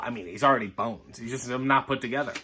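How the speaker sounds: plain reading voice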